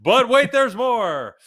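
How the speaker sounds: high, loud infomercial hype; infomercial announcer. Pretend. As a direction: shout it like a TV pitchman. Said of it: "the classic lines".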